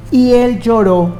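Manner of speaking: ordinary talk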